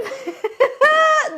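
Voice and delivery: high, loud burst of laughter; loud, high cackle of amusement; mocking laugh. Real, not acted. Laughs at the call log.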